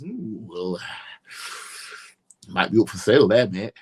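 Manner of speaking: a sighing, shrugging fan voice; mock resigned fan. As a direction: shrug off your own players with a sigh. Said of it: "what you're basically saying to everybody else".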